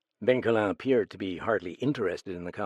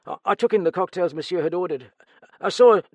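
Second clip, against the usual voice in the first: stammering, higher, louder frightened servant; nervous stammer; 8 semitones higher, 6 dB louder, faster than usual. Acted. Steward questioned about the murder, nervous stammer. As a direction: stumble over the words in fright.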